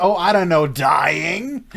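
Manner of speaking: ordinary talk, nothing marked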